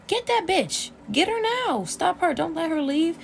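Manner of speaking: high, loud barked orders at the nurses; retold yelled order. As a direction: command staff to grab her, yelling. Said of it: "He's like".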